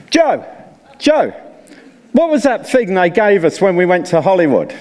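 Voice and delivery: calling out. Retold or imitated. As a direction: shout Joe's name across to a mate. Elder calls across to Joe.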